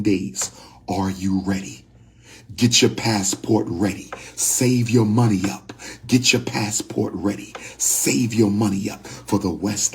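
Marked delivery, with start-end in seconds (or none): none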